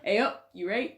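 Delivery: amateur put-on Yorkshire greeting; hammed-up northern English, Yorkshire style; Yorkshire impression. Shown as a demonstration. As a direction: have a go at a Yorkshire accent. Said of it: "I don't know what accent I'm doing".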